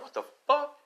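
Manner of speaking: high-pitched startled exclamation; acted high-voiced astonishment; shocked reaction. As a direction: blurt it high, in disbelief. People worldwide react to roar.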